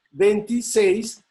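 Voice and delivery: "Veintiséis" lento y fuerte, marcando SÉIS; acento en SÉIS. Shown as a demonstration. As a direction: di "Veintiséis" despacio, recalcando la última sílaba, SÉIS. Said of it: "¿Y dónde lleva el acento?". ("Veintiséis" pausado, con el golpe de voz en SÉIS)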